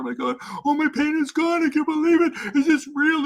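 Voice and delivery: high, loud, breathless disbelief; imitating her mother amazed the pain is gone; mother's overjoyed cry. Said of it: "she's like".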